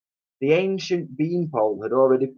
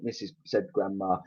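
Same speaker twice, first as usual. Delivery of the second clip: ordinary delivery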